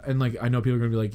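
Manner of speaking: normal talking voice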